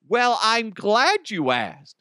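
glad you asked, slow and pleased; drawn-out showman reply; playful host voice; mock eager. Mock answer to own question.

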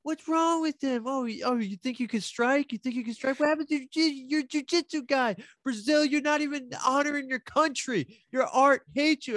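hammed-up furious fan voice; mocking angry fans. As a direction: jeer at the fighter in a high sneer. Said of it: "And they're like".

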